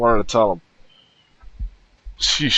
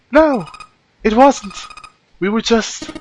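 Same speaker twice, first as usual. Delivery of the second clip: flustered; 7 semitones higher, slower than usual. Acted. Flustered Clover denies tryst.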